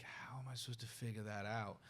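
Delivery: quiet whisper, retelling his younger self; whispered wondering. Retold or imitated. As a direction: whisper the question to yourself. Retold teenage self wondering.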